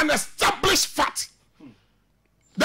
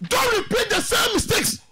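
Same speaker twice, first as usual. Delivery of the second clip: impassioned plea; 5 semitones higher than usual. Real, not acted. Pleading publicly to club chairman.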